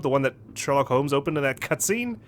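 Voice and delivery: usual speaking voice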